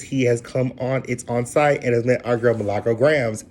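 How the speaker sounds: ordinary delivery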